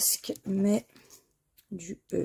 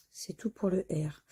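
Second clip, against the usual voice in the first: ordinary talk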